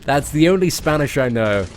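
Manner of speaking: ordinary delivery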